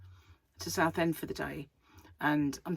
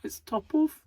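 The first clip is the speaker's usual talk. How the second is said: high-pitched, louder mock Brummie; exaggerated Birmingham accent; 11 semitones higher, 5 dB louder than usual. Shown as a demonstration. Vlogger blaming Pipkin for the accent, hammed-up Brummie. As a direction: put on a comically broad Birmingham accent. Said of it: "terrible exaggeration of their accent".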